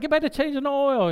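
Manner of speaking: high-pitched mock command; comic shooing order. Shooing the gawking workers, retold.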